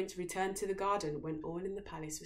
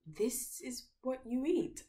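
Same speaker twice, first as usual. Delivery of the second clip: slow, higher appalled question; horrified princess; 7 semitones higher, slower than usual. A narrator's reading. Princess appalled at the mouldy food. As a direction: draw it out in disgust. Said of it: "asked the princess".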